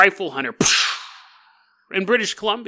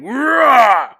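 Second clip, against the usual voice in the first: loud, high roaring grunt; straining heave; 7 semitones higher, 6 dB louder than usual. Pretend. Charlie throwing the tree aside, effortful roar.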